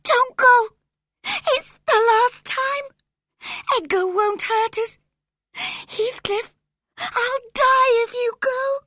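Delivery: very high, loud, tearful; cling to him with your voice; desperate pleading. A narrator's reading. Catherine desperately begging Heathcliff to stay, crying.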